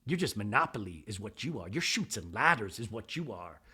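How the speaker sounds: low mock Mary. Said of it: "Mary goes".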